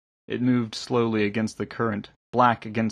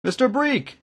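high-pitched hail; thin, high distant call; calling from shore. A narrator's reading. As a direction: call for someone across water. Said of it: "three people called out from the shore".

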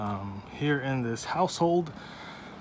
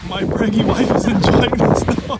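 raised voice over the wind; shouting over wind; 6 semitones higher, 6 dB louder than usual. Real, not acted. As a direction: call out over the gusts. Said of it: "if you can hear me through the wind".

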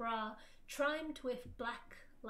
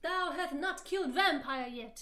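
louder, declaimed anger; hammed-up villain's reproach; said angrily; 3 semitones higher, 7 dB louder than usual. A narrator's reading. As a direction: thunder it a little, theatrically. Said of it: "he said angrily".

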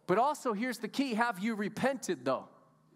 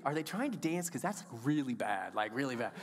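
mock judging thought; 8 semitones lower than usual. Retold thought watching someone.

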